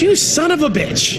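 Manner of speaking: high-pitched mock outburst at his son; retold sore-loser curse, pitched high; imitating angry dad. Dad loses hand, throws cards.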